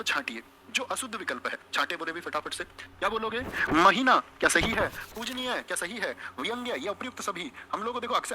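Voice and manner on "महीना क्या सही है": loud quiz question. Real, not acted. Teacher quizzing class loudly.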